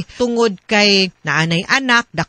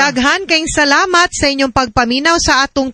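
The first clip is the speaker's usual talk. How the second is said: bright, raised announcer outro over music; radio sign-off; 5 semitones higher than usual. Acted. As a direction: close the show like a radio host thanking listeners. Radio hosts signing off programme.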